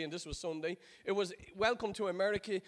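ordinary talk, nothing marked

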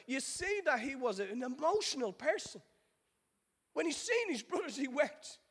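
high, driving sermon delivery; impassioned preaching. Acted. Preacher urging the congregation through Joseph's journey, impassioned. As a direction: preach it with rising and falling emphatic waves.

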